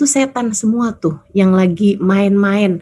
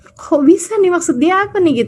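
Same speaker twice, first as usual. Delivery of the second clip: higher-pitched puzzled wondering; retold bafflement; 7 semitones higher than usual. Speaker recalls hearing the wife's puzzling answer, baffled. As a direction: replay your own astonished confusion. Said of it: "Heran juga saya waktu denger itu".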